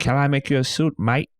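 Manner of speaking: hammed-up Aussie "mate" from a tailor; bad Aussie accent. Dad calling Aussie customers into his shop, bad Aussie accent. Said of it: "He changes his accent depending on who he's talking to".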